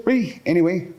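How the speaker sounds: usual speaking voice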